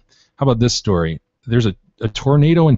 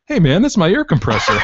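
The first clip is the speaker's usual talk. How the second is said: neighbor voice; 9 semitones higher, 4 dB louder than usual. Retold or imitated.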